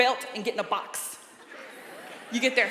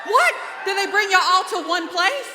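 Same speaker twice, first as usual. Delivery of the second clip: high, loud disbelieving what; mock surprise; 8 semitones higher, 8 dB louder than usual. Comedian teasing locals, loud mock-shocked what. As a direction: act shocked at the crowd.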